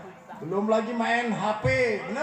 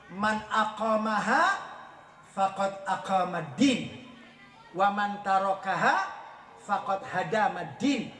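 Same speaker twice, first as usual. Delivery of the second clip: slow hadith recitation; 5 semitones lower, slower than usual. Shown as a demonstration. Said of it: "Makanya kata Nabi".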